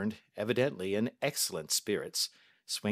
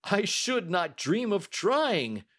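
slow, much higher, appalled banker; horrified refusal; 11 semitones higher, 5 dB louder, slower than usual. A narrator's reading. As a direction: recoil from the very idea. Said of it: "The banker recoiled in horror".

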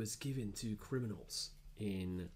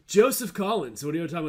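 hyped intro; 7 semitones higher, 12 dB louder, faster than usual. Acted.

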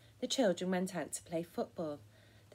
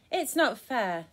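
louder storybook protest; mild shout; 3 semitones higher, 8 dB louder, slower than usual. A narrator's reading. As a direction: call it out as a mock team protest. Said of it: "the spiders on Scrabble's team shouted".

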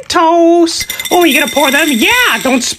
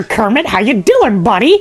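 ordinary delivery

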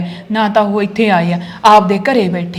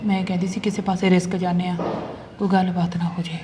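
hushed; 2 semitones lower, 8 dB quieter than usual. Acted. Wife whispers secret plan.

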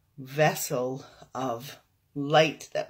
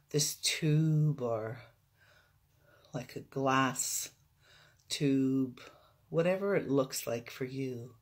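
guided meditation; 3 dB quieter than usual. Real, not acted. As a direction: guide them into stillness, unhurried.

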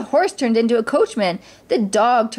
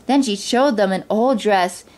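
plain reading voice

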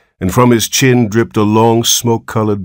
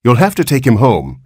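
higher-pitched firm order; insistent; 6 semitones higher, 4 dB louder than usual. A narrator's reading. Nurse tells the horrified father what to do, insistent. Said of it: "insisted the nurse".

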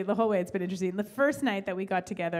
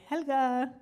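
Helga voice; 5 semitones higher, 4 dB louder, slower than usual. Pretend. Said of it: "I was Helga".

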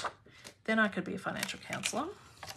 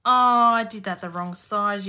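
louder, exasperated at her own mistake; oh no, wrong size, fed up with yourself; annoyed self-scold; 8 dB louder than usual. Real, not acted. Crafter scolding herself at her desk, exasperated and louder.

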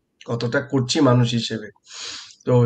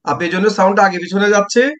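loud and high over a bad line; raised voice; 6 semitones higher, 4 dB louder, faster than usual. Real, not acted. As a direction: project over the echo. Talking over echoing line.

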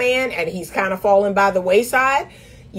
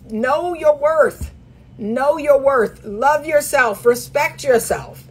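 slow; 3 semitones higher, slower than usual. Real, not acted. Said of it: "slow emphatic mantra".

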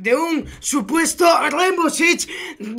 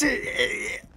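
ordinary talk, nothing marked

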